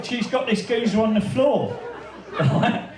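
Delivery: ordinary talk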